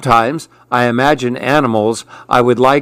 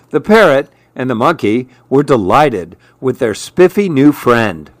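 ordinary delivery

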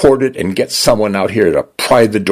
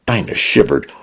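ordinary delivery

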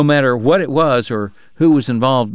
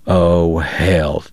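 lower, gruff exasperated outburst; Chalky crying out; 6 semitones lower than usual. Acted. Chalky to Bob about Wyatt Earp, low exasperated cry. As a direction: groan it in disgust. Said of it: "Chalky cried".

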